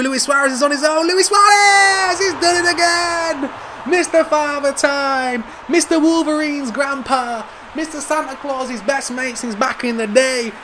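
high, drawn-out commentator roar; excited goal shout. Real, not acted. Gamer celebrating Luis Suarez scoring again, excited goal shout. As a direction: bellow each nickname slowly and high.

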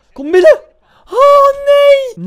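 high, loud cry of despair; anguished scream. Real, not acted. Gamer screaming as the ball goes in.

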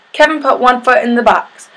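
plain reading voice

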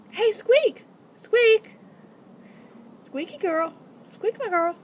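high, loud call by name; calling a pet. Real, not acted. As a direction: call sharply for your pet's attention. Calling another guinea pig to listen.

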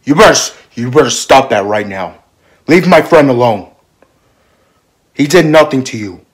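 loud, heated telling-off; angry confrontation. Acted. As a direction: stand up angrily for a friend. Defending friend against Josh.

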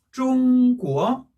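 "중국어" said as Chinese zhōngguó, high level then rising; first-second tone. Shown as a demonstration. Teacher drilling the pattern, "중국어" level then rising. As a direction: slowly hold "중" high, then lift "국어". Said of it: "일 성, 이 성일 때는".

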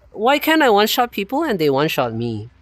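usual speaking voice

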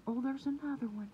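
ordinary talk

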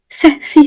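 "Se, si" with the tongue tip between the teeth; lisped se si. Shown as a demonstration. Teacher demonstrating the lisped "Se, si" to students. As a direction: lisp "Se, si" like a th sound. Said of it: "dilimin ucu hafif dışarıda".

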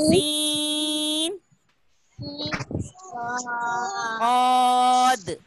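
जा, सीन, औद said heavy and firm, drawn out; heavy letters. Shown as a demonstration. Teacher leading children in heavy letters, firm and drawn out. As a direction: stretch each heavy letter, firm and full. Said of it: "सख्ती वाले हुरूफ पढ़ते हैं".

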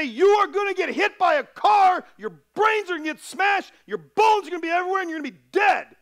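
father shouting at his son about the road, high and loud; yelling warning. Retold or imitated. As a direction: scare the kid straight at full volume. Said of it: "And then I yell. And I say".

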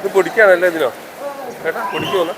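ordinary talk, nothing marked

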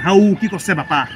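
Nalbari-accented Assamese question. Shown as a demonstration. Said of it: "Nalbari they have different accent".

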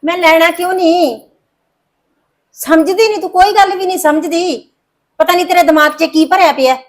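mother shouting at defiant daughter; angry outburst. Acted. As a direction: blow up at her stubbornness. Mother explodes in frustration.

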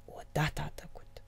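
hushed, breathless aside; whisper. A narrator's reading. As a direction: whisper it for effect. Storyteller drops to whisper. (șoaptă)

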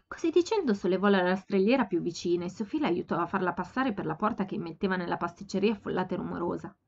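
ordinary delivery

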